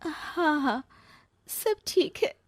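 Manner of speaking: high voice cracking into a cry; breaking into tears. Acted. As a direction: she says she's fine while starting to weep. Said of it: "ये कहते कहते ही वो रोने लगती है". (रोते हुए, टूटती आवाज़ में)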